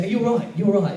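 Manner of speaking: a high, chirpy hint of London; London greeting. Comedian imitating how they greet you in London, light London mimicry. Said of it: "like, in London, they say".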